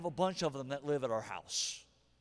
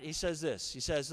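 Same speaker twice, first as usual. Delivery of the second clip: ordinary delivery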